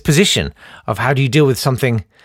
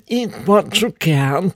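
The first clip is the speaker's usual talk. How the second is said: put-on slow, higher frail old lady voice; Auntie Ruby; 4 semitones higher, slower than usual. Great-aunt's saying, in a lightly voiced old lady. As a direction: quote her in a tiny trembling old voice. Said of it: "she was the one who said".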